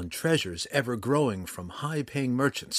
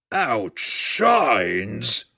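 a loud, rising roar of outrage from the dragon; roaring cry; 7 dB louder than usual. A narrator's reading. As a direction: let the word shake the chamber. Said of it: "Hephaestus cried".

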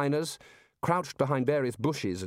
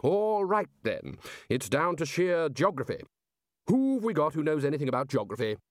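higher, emphatic voice of the boss; brisk authority, punching the openings; decisive senior wizard; 4 semitones higher than usual. Acted. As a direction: take charge and call for an expert.

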